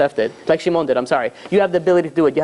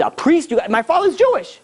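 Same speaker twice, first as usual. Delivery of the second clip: high-pitched incredulous outburst; appalled, high-pitched retort; outraged disbelief; 11 semitones higher than usual. Retold or imitated. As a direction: act out going crazy, voice leaping way up. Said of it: "going crazy".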